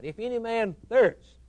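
high and loud; ringing proclamation. Acted. Preacher proclaiming Christ's invitation at full voice. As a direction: lift it up like a cry over the crowd.

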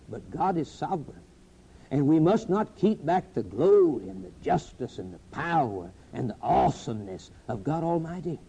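ordinary delivery

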